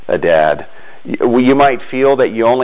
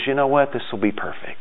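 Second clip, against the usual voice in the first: soft, much quieter, tender; gentle Jesus voice; 3 semitones higher, 8 dB quieter, faster than usual. Retold or imitated. Jesus to the disciples over the loaves, softly pleased. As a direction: hush it, as if delighted by a small gift. Jesus looks at little food.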